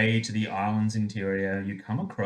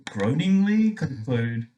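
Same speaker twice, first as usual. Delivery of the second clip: ordinary talk, nothing marked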